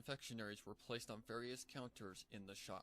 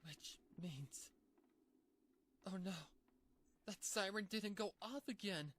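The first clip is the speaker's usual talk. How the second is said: higher and louder building to panic; high pony voice spiralling into fear; rising panic; 10 semitones higher, 4 dB louder than usual. Acted. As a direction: climb into alarm as it sinks in. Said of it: "Pinky began to panic".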